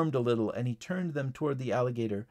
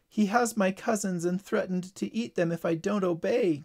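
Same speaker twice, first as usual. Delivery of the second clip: mildly distressed, higher-pitched cry; a touch of distress, pitch raised; 6 semitones higher than usual. Acted. Lydia confessing Frog's threat to Tyler, mildly distressed. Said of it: "cried Lydia".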